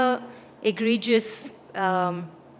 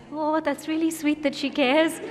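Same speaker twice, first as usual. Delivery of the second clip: higher, fond little voice with a laugh in it; touched inner Indian self; 6 semitones higher than usual. Retold or imitated. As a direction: coo it like the sentimental girl from home. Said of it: "the tiny little Indian left in me is going".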